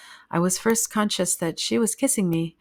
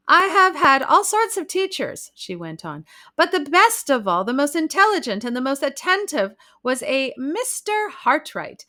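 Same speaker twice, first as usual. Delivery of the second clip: higher, eager lady's voice; chatty society lady; 6 semitones higher, 5 dB louder than usual. A narrator's reading. Hostess urging the bride to take lessons, eager. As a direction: insist pleasantly, a little gushing.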